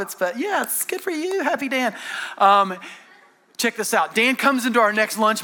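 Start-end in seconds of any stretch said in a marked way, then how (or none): 0.4-1.9 high, sing-song patronizing boss; mock-sweet talking down in a high voice; condescending. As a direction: imitate fake congratulations, pitched way up. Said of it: "it's condescending".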